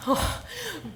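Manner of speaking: quick, delighted intake of breath; excited gasp. Acted. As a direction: gasp as a happy thought hits. Teen remembers her holiday plans.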